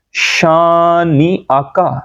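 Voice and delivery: "شانی آکا" slow, long shaa and a clean catch before آ; stretch, then restart on آکا in "شانی آکا"; hamza sounded. Shown as a demonstration. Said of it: "حمزہ کی آواز ہم skip کر".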